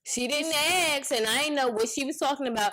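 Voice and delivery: stressing disbelief; indignant. Real, not acted. Indignant agreement about mom.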